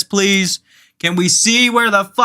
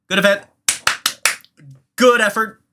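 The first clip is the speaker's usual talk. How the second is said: ordinary delivery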